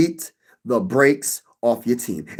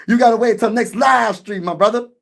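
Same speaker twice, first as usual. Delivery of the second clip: raised, teasing call-out; playful shout; 7 semitones higher than usual. Acted. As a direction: holler it at the fan for emphasis. Repeating the same line louder.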